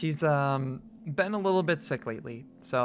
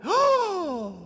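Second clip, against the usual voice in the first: dog's excited gasp; 13 semitones higher, 5 dB louder than usual. Pretend. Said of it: "she's like".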